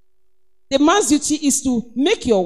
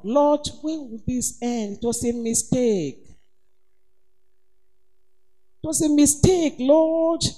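plaintive prayer; 6 dB quieter than usual. Acted. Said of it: "She looks up".

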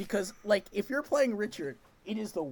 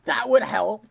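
higher, louder sarcastic retort; mock a sarcastic put-down; sarcastic queen; 4 semitones higher, 5 dB louder than usual. Queen Elizabeth snapping back at Richard, sarcastic. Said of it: "she's like".

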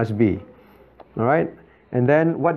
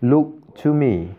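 slow gentle command; much slower than usual. Acted. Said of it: "So Christ said".

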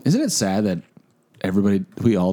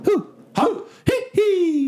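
very high Mario yelps; Mario jump cries; slower than usual. Retold or imitated. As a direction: squeak like Mario jumping. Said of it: "and he's like".

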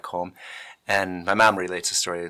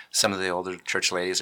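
normal talking voice

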